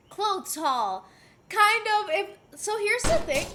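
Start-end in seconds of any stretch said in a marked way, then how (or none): none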